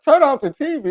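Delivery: put-on kid echoing mama. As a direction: parrot mama's order in a high kid's voice. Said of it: "I'm like".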